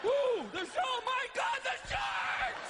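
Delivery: hammed-up terror, screamed out; comic panic at full pitch; panicked screaming. Acting out panic over sharks.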